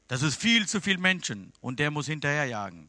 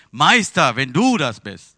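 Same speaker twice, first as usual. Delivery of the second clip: calling out; 10 dB louder, slower than usual. Acted. Petrus calls out to Jesus.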